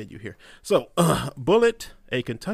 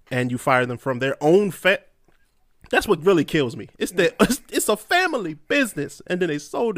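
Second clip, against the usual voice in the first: angry rant; 5 semitones higher, 7 dB louder than usual. Real, not acted. Ranting about the firing.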